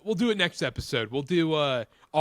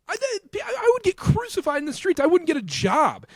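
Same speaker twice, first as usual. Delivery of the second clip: exclaimed; 13 semitones higher, 3 dB louder than usual. Real, not acted. Repeats outrage, pitch jumps.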